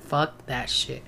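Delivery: muttered curse. Retold or imitated. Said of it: "'Cause I was like".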